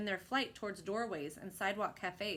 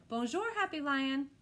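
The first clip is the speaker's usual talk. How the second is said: put-on a bit higher, child's bonjour; lightly voiced happy child greeting; a lightly voiced little boy calling; 7 semitones higher, 4 dB louder than usual. A narrator's reading. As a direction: call out like a small boy. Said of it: "a little voice cried".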